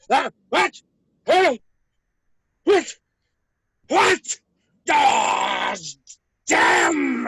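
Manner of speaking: high, loud bursts of alarm; startled shouting. Acted. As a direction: cry out as if jolted to your senses. Startled awake, finds himself still trapped.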